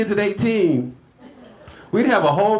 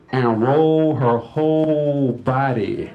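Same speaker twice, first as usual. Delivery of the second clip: normal talking voice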